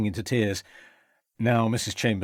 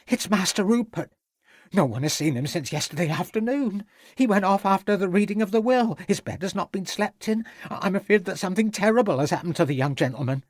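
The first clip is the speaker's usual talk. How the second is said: worried; 9 semitones higher than usual. A narrator's reading. Cook fears for missing Rupert.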